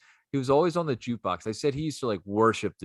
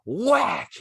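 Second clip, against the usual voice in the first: high, sudden "whack"; imitated slap; 9 semitones higher, 3 dB louder than usual. Guy smacks George in the face, sudden high whack. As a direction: pop the word out like a blow.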